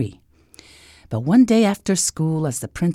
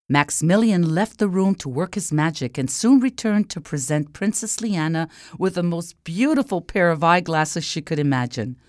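ordinary delivery

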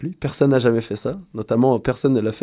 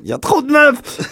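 shrill fake outrage, very high; voix aiguë moqueuse; 14 semitones higher, 4 dB louder than usual. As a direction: squeal it in a high mock voice. Cri moqueur des gars. (voix très aiguë moqueuse)

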